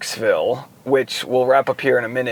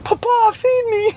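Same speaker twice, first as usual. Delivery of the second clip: put-on very high, pleading little British boy; the dog begging in a squeaky British kid voice; 3 dB louder than usual. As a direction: beg for food like a tiny British boy. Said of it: "like, a British boy".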